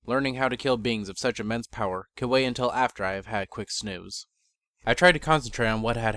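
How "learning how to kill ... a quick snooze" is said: ordinary talk, nothing marked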